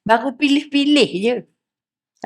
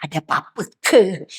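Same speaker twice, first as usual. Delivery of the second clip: suspicious muttering; 7 semitones lower, 5 dB quieter than usual. Shown as a demonstration. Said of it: "Jangan kata".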